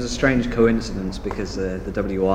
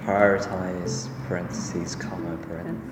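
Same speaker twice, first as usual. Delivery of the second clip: slow, literal read of punctuation; dictating; 3 dB quieter than usual. Shown as a demonstration. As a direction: spell out each mark deliberately. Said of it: "Do we say it like that?".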